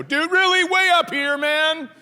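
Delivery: high, incredulous call. Acted. Walker challenging neighbour over his flag, high incredulous call. Calling to man with flag outside.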